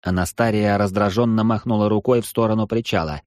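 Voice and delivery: plain reading voice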